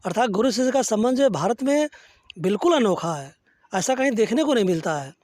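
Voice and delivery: ordinary delivery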